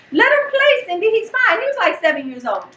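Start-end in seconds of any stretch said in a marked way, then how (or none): 0.1-1.6 lightly imitated carefree higher voice; higher-pitched quote, brushing off worry; retold plea. Relative telling mom to let him play, retold reassurance. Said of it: "Janae was like".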